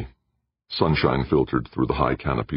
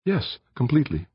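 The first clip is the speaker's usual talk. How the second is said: higher, lighter female voice for Aditi; Aditi's voice; 11 semitones higher than usual. A narrator's reading. Aditi answers Jordan in her higher voice.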